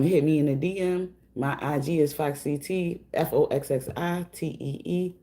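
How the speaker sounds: ordinary delivery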